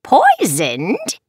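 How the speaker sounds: high, mock-shocked witch; incredulous witch squawk; amused witch. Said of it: "burst into a fit of cackling".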